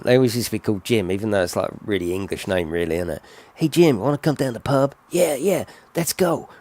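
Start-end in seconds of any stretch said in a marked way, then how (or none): 3.5-6.5 higher put-on American chat. Toy cars planning a pub trip, American voice. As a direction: childhood make-believe in an American accent. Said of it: "do this American voice".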